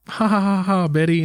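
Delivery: forced stage chuckle; awkward fake laugh. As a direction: laugh it off as a mock nervous actor. Acting Ryan laughing off insult.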